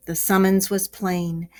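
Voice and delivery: ordinary delivery, nothing marked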